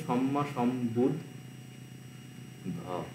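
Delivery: slow, soft syllable-by-syllable demo of "সম্মা সম্বুদ্ধ"; slow syllable split. Shown as a demonstration. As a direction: break "সম্মা সম্বুদ্ধ" apart at each syllable so students hear the cut. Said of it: "এইখানে কিভাবে কাটছে?".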